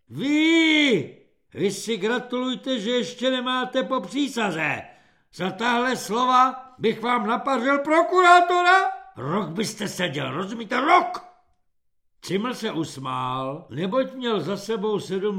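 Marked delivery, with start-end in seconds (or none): 0.1-11.2 high, loud outraged outburst; furious threat. A narrator's reading. Corporal threatening a recruit with prison, yelling. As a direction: explode at the insolent recruit.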